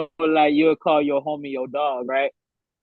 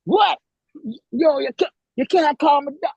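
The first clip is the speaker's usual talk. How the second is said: imitating angry friend; 11 semitones higher than usual. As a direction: act out the offended friend protesting. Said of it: "he was mad".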